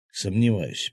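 ordinary delivery

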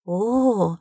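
drawn-out, amazed "oh"; stunned exclamation. A narrator's reading. Sherlock reacts to the secret programme, stunned. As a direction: exclaim as if greatly impressed. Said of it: "воскликнул потрясённый Шерлок".

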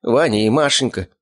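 name them slowly to draw pity; playing for pity. A narrator's reading. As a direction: captive tugging at heartstrings. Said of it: "продолжал он давить на жалость".

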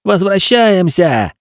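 high, slow yell; shouting. A narrator's reading. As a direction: call out over the noise. Continues shouting.

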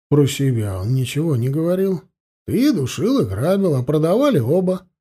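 plain reading voice

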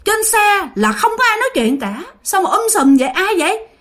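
high, frightened questions; a frightened driver, pitched high; scared driver. Acted. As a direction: ask it with rising fear. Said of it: "Ông nói".

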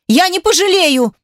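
high-pitched, indignant outcry; shouted protest. A narrator's reading. Wife rejects husband's warning she will regret it, shouted. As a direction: cry it out in heated denial. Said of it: "прокричала Лора".

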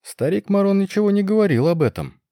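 high, bewildered remark. A narrator's reading. Succubus in the nobles' box reacting to the news, bewildered. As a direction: say it at a loss, pitched well up. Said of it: "таким же растерянным голосом сказала Суккуба".

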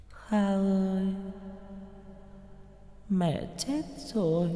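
soft, high, slow grieving lament; sobbing ghostly voice. Acted. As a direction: sob it quietly like a lost mother. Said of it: "giọng nói nức nở". (giọng nức nở thều thào)

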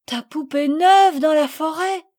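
high, loud shocked question; startled exclamation. A narrator's reading. Mother crying out in alarm at the lost doll. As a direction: cry out in disbelief. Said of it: "s'écria madame de Rosebourg".

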